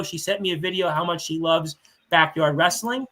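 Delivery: ordinary delivery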